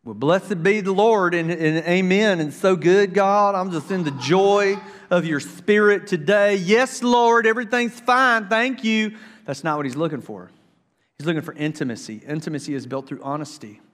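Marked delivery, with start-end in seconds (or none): 0.0-9.2 high, loud, sing-song mock piety; mock the fake-happy prayer; fake cheery prayer. Pastor mocking a shallow prayer, high fake cheer. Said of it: "you don't just say".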